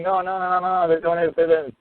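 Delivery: high, mock-frantic objection; frantic protest. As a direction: imitate people protesting in a high pitch. Acting out supporters' frantic objections.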